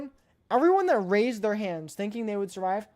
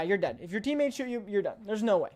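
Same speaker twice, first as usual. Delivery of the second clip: usual speaking voice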